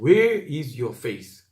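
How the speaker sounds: slow, steady English reading of the line; slow English repeat. Shown as a demonstration. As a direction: repeat it slowly for weight. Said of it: "영어 성경에는 진짜 그렇게 번역". (영어 구절을 천천히 되풀이)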